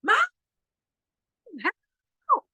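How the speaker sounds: high shrill yell for mom; child's panicked high cry for mom; panicked call. Retold or imitated. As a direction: scream for help like a scared child. Girl calling out in panic.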